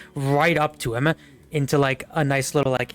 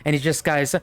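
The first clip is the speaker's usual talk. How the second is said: ordinary talk, nothing marked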